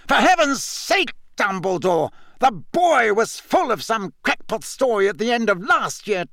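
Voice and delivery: high-pitched exasperated protest; indignant, incredulous tirade; angry. A narrator's reading. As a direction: argue heatedly, pitch leaping up. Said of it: "said Fudge angrily".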